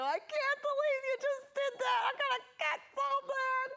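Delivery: very high, loud, slow mock screech; hammed-up shrill mom yell; yelling through a smile. Shown as a demonstration. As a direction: yell at the kids with a fixed smile. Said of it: "when I yell at my kids, I smile like this".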